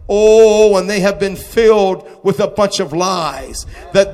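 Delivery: loud, high, driving sermon cry; full-force preaching. Acted. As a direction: preach it out loud to the whole church. Preaching at full force over music.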